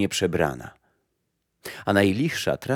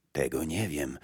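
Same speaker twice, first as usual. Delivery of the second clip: put-on low, much softer old woman; old grey woman; 5 semitones lower, 10 dB quieter than usual. Old woman tells the blind mother death is not back, low and soft. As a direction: answer like a quiet old gardener of graves. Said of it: "odpowiedziała staruszka".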